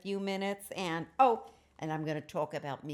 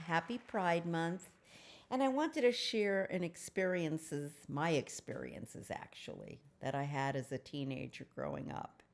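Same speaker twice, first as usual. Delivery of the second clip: ordinary delivery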